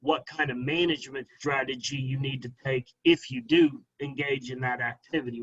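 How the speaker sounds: ordinary talk, nothing marked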